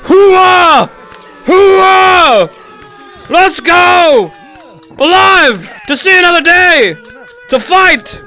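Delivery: high, loud military battle cry; rally the squad with a cheer; loud gung-ho soldier. Real, not acted. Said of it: "just give a fuck yeah".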